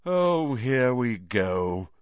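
slow, drawn-out weary resentment; bitter. A narrator's reading. Nick hearing the hunting horn, bitter. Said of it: "said Nearly Headless Nick bitterly".

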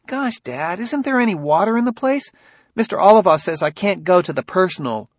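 put-on high, whiny kid's voice; a boy griping to his dad; young son Ben, complaining.

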